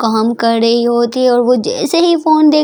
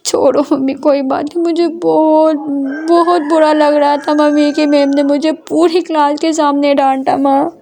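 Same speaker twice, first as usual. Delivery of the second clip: crying; 3 semitones higher than usual. A narrator's reading. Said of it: "Ishani है वो रोती है, बोलती है".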